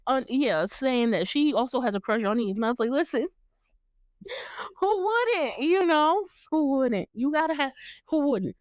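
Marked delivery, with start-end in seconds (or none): 2.9-8.6 gushing. Retold or imitated. Host gushing about Eve.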